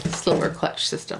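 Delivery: slow awed whisper of the name. Pretend. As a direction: breathe it out slowly, in awe. Savoring the part name in awe.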